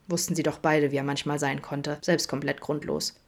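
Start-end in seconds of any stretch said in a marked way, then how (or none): none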